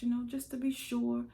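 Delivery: high, loud justification; insistent self-reassurance. Acted. Scared woman justifying reaching for the light, loud and high.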